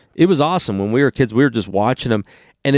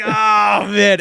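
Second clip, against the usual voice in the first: laughing shout; 8 semitones higher than usual. Retold or imitated. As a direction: cry out at the roast. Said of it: "And we were like".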